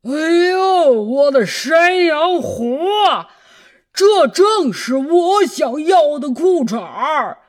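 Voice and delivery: loud excited cry. Acted. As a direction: shout out in delight. Wolf thrilled by best underpants.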